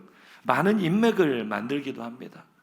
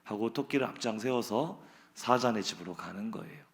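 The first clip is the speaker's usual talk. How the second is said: normal talking voice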